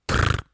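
short low rolled trill; a toneless rattle of the tongue; beetle trill. Acted. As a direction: buzz a low insect "trrr".

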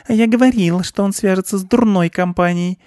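high-pitched scolding aunt mouse; huffy female shrew; indignant shrew aunt. A narrator's reading. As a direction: scold in a high, self-righteous voice. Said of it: "подбоченилась рыжеватая крупная мышь-землеройка".

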